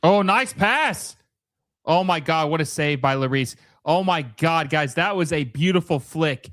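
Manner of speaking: high, thrilled reaction to a great play; excited commentary. Real, not acted. Commentator marvelling at a beautiful flick and save, excited.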